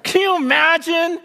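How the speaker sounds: high, forceful, driving sermon; impassioned preaching. Real, not acted. Preacher voices Jesus promising his return, fervent and forceful. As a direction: preach it with building insistence, starting very high.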